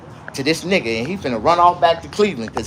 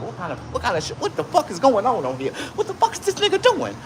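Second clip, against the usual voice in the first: softer, rising into high-pitched bafflement; confused newcomer; 7 semitones higher, 3 dB quieter than usual. Acted. Imagined viewer stumbling into the livestream, mock confusion. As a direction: act out a bewildered viewer. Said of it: "confused like a motherfucker".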